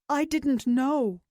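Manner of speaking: sorrowful. Acted. Dying old man repeats to Glimmer.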